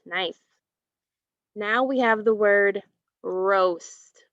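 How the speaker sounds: ordinary talk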